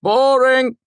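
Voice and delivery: very high, loud heckle; loud interjection. A narrator's reading. Lecturer cutting off a student's fact, loudly. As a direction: cut in loudly over the answer. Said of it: "Elodin interjected, cutting him off".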